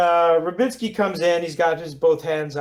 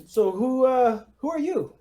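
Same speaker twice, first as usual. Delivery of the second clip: put-on Russian accent; 9 semitones higher than usual. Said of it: "rough, Russian sort of accent".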